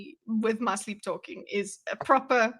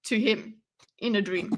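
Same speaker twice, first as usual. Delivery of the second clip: usual speaking voice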